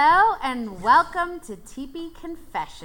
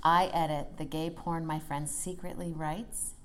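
sultry narrator; 6 semitones lower, 5 dB quieter than usual. Acted. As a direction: read it like a sultry secret. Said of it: "we've been practicing our sultry voice".

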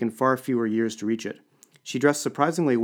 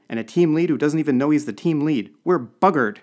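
high, loud outburst; alarmed exclamation; 5 semitones higher, 6 dB louder than usual. A narrator's reading. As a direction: exclaim it in dismay. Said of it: "he exclaimed".

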